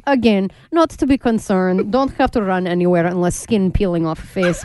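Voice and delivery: put-on lightly Russian-accented TV news anchor; halting foreign newsreader, mock-calm. Mock Russian newscaster bit.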